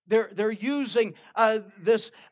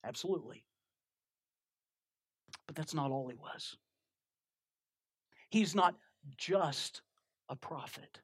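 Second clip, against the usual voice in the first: hushed preaching; 7 semitones lower, 8 dB quieter than usual. Real, not acted. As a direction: draw them in, nearly murmuring. Preacher's quiet intense close.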